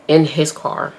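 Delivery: normal talking voice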